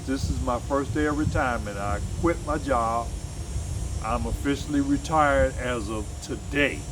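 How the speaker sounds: ordinary delivery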